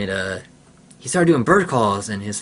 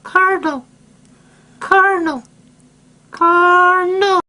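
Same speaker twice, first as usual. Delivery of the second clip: much higher birdcall, last one slow and drawn out; cardinal call imitation; 4 dB louder, slower than usual. Kid imitating a cardinal for viewers, high birdcall. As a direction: sing the name like a cardinal. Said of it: "It's slightly different".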